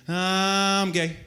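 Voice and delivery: lightly voiced high car whine saying it; a hint of a passing Prius mocking; Prius engine voice. Comic imitating the Prius engine sound, mild high mock voice. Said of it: "all you hear is".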